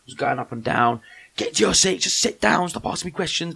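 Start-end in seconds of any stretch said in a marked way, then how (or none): 1.4-3.5 higher-pitched irritated ordering about; mock bossy telling-off; proper aggro. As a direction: imitate an aggressive staff member telling people off. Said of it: "proper getting proper aggro".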